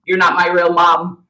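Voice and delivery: put-on loud, high bratty teen retort; bratty comeback. Mom mimicking the aunt's reply, loud and bratty. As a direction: sass it like a defiant niece. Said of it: "And you said".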